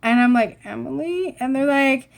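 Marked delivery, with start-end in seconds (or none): 0.6-1.4 small, quiet, questioning voice; hesitant question. Retold or imitated. Timid friend answering the genies who ask a name, hesitant question. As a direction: softly offer the name, rising.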